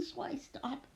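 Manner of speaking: plain reading voice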